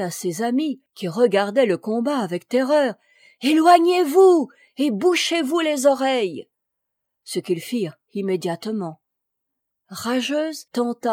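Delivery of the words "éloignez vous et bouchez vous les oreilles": loud high call over noise; shouting. A narrator's reading. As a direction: shout a warning to friends. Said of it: "l'attention de crier". (crier un avertissement)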